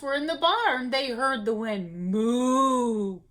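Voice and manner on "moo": moaning cow. A narrator's reading. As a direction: moan it like a sad cow. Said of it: "cow moaned".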